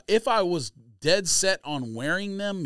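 ordinary talk

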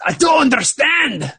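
high, loud Scottish wail; exaggerated Scottish bewilderment; mock Scottish accent. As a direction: cry it out in a hammy Scottish accent. Resumes the bad Scottish character.

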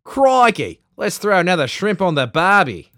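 loud, high hammy Aussie; over-the-top Australian accent. Pretend. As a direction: shout it like a cartoon Aussie. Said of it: "I just, I can't even".

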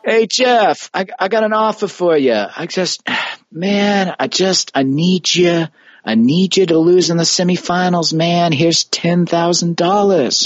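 high Italian mobster offering a bribe; Italian mob boss voice, leaning in; Italian mafia accent. Retold or imitated. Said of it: "You voicing the mafia?".

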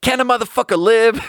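high-pitched exasperated mock outcry; Larry David. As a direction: cry it out high, pleading to the camera. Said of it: "Larry David goes".

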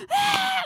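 short high imitated scream; retold terrified scream; mock scream. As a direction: shriek like she did that night. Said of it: "I was just like".

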